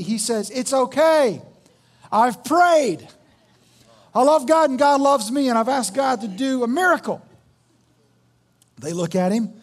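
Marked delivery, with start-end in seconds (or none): 0.5-7.2 high, loud, insistent assurance; confident refusal. Acted. Man on the roof refusing a helicopter, loudly confident. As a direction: turn down help with total conviction.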